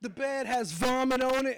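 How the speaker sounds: ordinary talk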